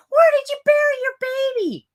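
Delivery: high, fast, loud accusing shout; mock yell. Imitating idiots yelling at the mom, high and loud. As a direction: shout it at the grieving mother.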